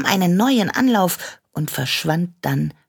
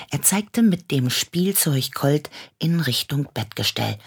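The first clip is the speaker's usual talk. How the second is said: ordinary delivery, nothing marked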